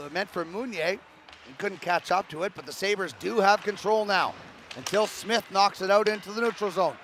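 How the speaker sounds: normal talking voice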